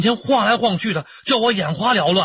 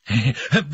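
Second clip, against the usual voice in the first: pleased laugh; 5 semitones lower than usual. Acted. Flattered by praise.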